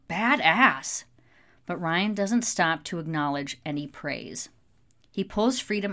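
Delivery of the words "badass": louder, brief admiring shout; yelled cheer. A narrator's reading. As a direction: call it out from the crowd. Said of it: "One guy yells out".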